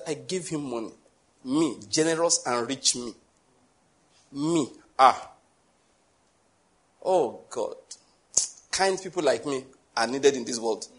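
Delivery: smug self-praise. Retold or imitated. Mocking a proud giver.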